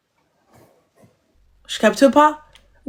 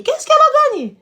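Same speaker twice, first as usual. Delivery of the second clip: very high scoffing question; outraged disbelief, pitched very high; incredulous. Acted. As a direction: squeal the question in mock disbelief. Mocking disbelief.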